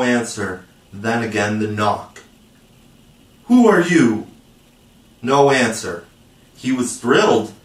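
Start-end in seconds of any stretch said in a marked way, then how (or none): 3.5-4.3 louder, higher demand to know; challenging call. A narrator's reading. Peter to the silent knocker outside his door, challenging.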